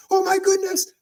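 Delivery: high-pitched imitated gush; starstruck fan. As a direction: squeal it like a thrilled fan on the phone. Retelling a starstruck fan on phone.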